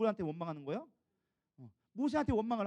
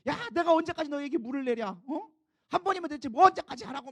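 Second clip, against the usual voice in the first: rising angry shout at the people; angry outburst; 6 semitones higher, 5 dB louder than usual. Retold or imitated. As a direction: snap at them, fed up. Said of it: "화를 버럭 낸". (버럭 화내며)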